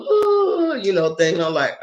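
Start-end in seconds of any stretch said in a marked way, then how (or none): 0.0-0.8 high breathy mock moan; a hammed-up high ooh. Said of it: "her normal ... thing".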